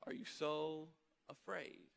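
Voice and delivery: soft, slow, tender voicing of Jesus; Jesus calmly asking his frightened disciples; gentle question. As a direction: ask it quietly, with compassion. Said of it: "and he says".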